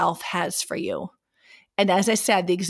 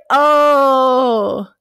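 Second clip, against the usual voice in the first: surprised; 4 semitones higher, 9 dB louder than usual. Retold or imitated. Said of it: "I was like".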